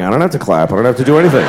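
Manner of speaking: sit back knowing you won't clap; smug older crowd. Retold or imitated. Smug older audience members.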